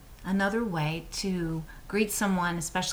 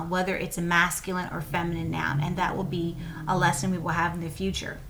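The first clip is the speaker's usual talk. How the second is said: ordinary talk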